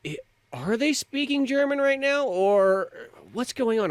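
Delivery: high-pitched puzzled viewer; mock confusion. Acting out a confused film viewer.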